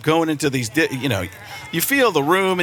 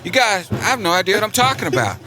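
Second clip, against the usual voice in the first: Bush impression; 2 semitones higher than usual. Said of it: "Bush, I could just go".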